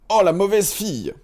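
high, loud dwarf casting a curse; dwarf cursing the girl, high and loud. A narrator's reading. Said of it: "dit le cinquième".

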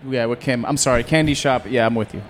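ordinary talk, nothing marked

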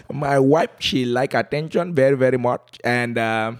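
put-on comic, thick Calabar accent with chuckles. Comedian tells host about his wife, thick Calabar accent. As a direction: plead your case in a playful Calabar accent. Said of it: "here's my Calabar accent".

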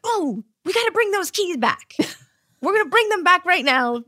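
hammed-up excited TV writer with a laugh; mock excited writer. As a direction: play the writers as breathlessly eager. Mocking the writers' sudden decision.

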